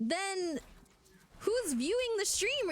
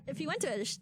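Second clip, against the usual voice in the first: normal talking voice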